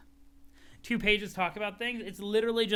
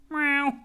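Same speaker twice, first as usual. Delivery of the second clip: cat meow; 8 semitones higher, 7 dB louder than usual. Acted. Cat meows.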